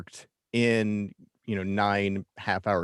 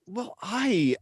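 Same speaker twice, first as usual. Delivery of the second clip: ordinary talk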